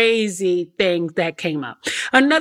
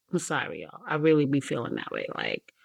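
laughing, softer apology; 9 semitones lower, 10 dB quieter, faster than usual. Real, not acted.

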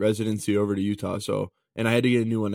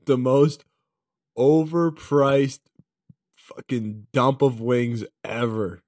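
drawn-out, weighty emphasis on each chunk; emphatic scorn; 2 semitones higher, 4 dB louder, slower than usual. Real, not acted. Friend trashing the wing place to his buddy, slow emphatic scorn. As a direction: lean hard and slowly on the put-down.

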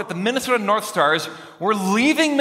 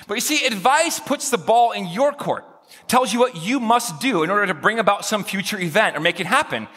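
normal talking voice

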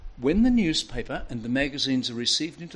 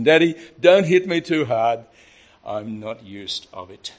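family catchphrase; 9 dB louder than usual. Retold or imitated. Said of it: "Everybody knows that expression".

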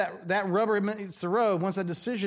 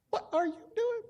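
high but softer, bewildered; baffled; 4 dB quieter than usual. Retold or imitated. Pastor asking the neighbour again, high, softer and bewildered. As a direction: ask it again, quieter and puzzled. Neighbour climbs into his boat.

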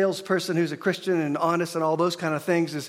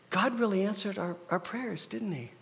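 hushed wonder; 6 dB quieter than usual. Retold or imitated. Salesman's hushed remark.

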